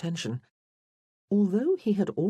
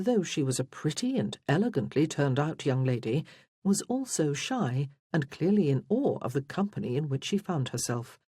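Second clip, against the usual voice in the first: usual speaking voice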